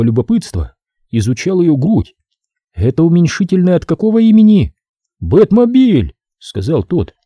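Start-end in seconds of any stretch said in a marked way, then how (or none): 2.7-4.7 higher, brisk woman's voice; a businesslike woman explaining the job; woman worker. Acted. Sophie voiced. 5.2-6.1 proud nerd. Acted. Bet voiced.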